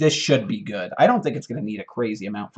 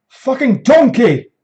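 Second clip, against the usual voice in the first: much higher, louder furious chef yell; Gordon Ramsay; 11 semitones higher, 11 dB louder, slower than usual. Acted. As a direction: roar it like an angry TV chef. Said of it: "this is Dan Ramsey, Gordon Ramsay".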